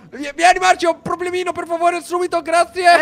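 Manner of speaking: high, loud frantic plea; panicked plea. Acted. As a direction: beg for help as the leopard attacks. Chiede aiuto contro il leopardo. (supplica acuta e forte, in preda al panico)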